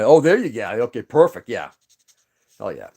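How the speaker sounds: usual speaking voice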